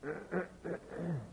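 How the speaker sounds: soft, attention-seeking throat clear; imitated throat clearing. Couple coughing to get the diners' attention, imitated throat clearing. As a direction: clear the throat discreetly to be noticed. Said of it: "And so they coughed".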